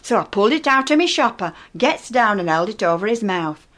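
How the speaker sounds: ordinary talk, nothing marked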